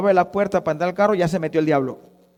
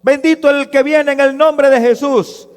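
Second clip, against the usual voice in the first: high, loud acclamation; crowd's shout of praise; 7 semitones higher, 8 dB louder than usual. Acted. Same crowd praising Jesus at his entry, shouted acclaim. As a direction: cry out praise at sustained full volume. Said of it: "con grito, con el corazón".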